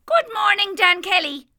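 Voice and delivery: high sprightly leprechaun voice; a cheeky little fairy man greeting. A narrator's reading. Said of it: "said the leprechaun".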